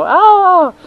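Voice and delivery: very high, loud panicky wail; scared child's cry. Retold or imitated. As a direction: squeal like the frightened boy. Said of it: "hear this kid go".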